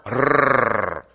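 long trilled "Rrrr"; "Rrrr" as one drawn-out Spanish rolled R. Shown as a demonstration. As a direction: trill the tongue on a sustained "Rrrr". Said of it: "can you roll an R".

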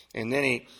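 usual speaking voice

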